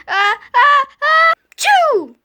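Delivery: loud high ah-ah-choo; drawn-out sneeze. Acted. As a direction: build up and sneeze.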